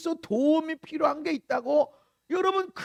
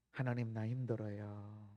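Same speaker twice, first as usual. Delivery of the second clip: low, very soft admission; confessing to God; 14 dB quieter than usual. Shown as a demonstration. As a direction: confess quietly that you are struggling. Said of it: "고백하세요". (낮고 조용한 고백)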